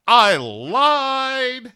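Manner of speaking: high, loud drawn-out gotcha; gleeful reveal. Acted. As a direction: stretch it out high like a mocking punchline. Grumpy Cat interrupts with gleeful reveal.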